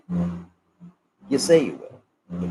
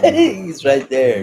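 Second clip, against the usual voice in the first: slow words through loud laughter; laughing; 5 semitones higher, 9 dB louder, much slower than usual. Real, not acted. Telling viewers he's right there, laughing loudly. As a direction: laugh it out while pointing. Laughing over neighbour's yell.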